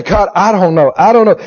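acted fervent prayer, rising in pitch; crying out to God. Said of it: "put your nose in the carpet and say".

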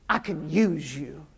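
gentle low word from God; God speaking. Acted. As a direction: quietly voice God's assurance. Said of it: "God said".

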